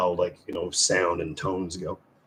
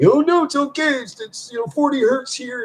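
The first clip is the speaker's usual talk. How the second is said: high, mocking squeaky voice; mocking tech guys; 4 dB louder than usual. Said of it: "and they're like".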